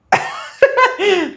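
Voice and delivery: real amused laughter; high laugh. Real, not acted. Laughing at own story.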